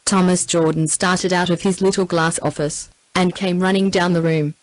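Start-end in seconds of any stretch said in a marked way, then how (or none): none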